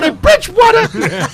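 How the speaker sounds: high excited announcer yell; hype shout. Shown as a demonstration. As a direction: belt the name out like a hype man. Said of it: "when you say it, you gotta say".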